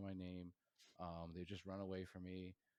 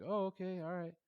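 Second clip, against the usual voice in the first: high, loud startled okay; sheepish surprise; 12 semitones higher, 11 dB louder than usual. Retold or imitated. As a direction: imitate being caught off guard. Sheepish reply, retold.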